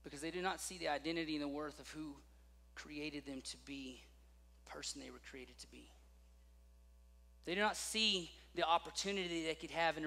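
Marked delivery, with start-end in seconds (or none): 0.0-5.9 soft, low, slowing into a near whisper; hushed. Real, not acted. Preacher to the congregation, softening into a near whisper. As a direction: let it sink to a whisper. Sermon point trailing to whisper.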